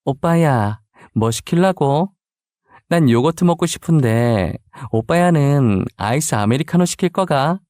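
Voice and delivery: natural Busan satoori lilt; easy Busan dialect, asking a boyfriend. Acted. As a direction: speak like a Busan native, casually. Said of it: "갑자기 부산 사투리를 너무 자연스럽게".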